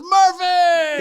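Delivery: high, loud happy yell of a name; delighted shout of recognition. Retold or imitated. Coach finally recognizing his old walk-on on the call, loud happy shout. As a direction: greet him like a long-lost player.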